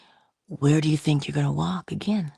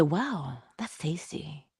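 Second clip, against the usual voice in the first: soft, understated praise; quiet polite compliment; 8 dB quieter, slower than usual. Shown as a demonstration. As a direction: murmur a mild compliment. Demoing polite food comment.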